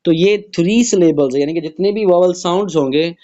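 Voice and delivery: normal talking voice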